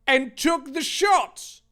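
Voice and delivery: ordinary delivery, nothing marked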